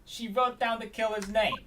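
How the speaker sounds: slow, high, loud Brooklyn detective; Brooklyn accent. Acted. Detective accusing suspect over the note, emphatic Brooklyn accent. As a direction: declare it slowly in hammy Brooklyn. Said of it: "Let's do a Brooklyn accent".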